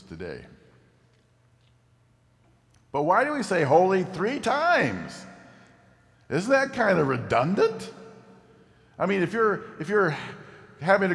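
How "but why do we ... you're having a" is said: high, loud incredulous questioning; playfully bewildered, louder; mock puzzlement. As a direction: pitch it way up like a baffled objector. Preacher poses a mock-puzzled objection.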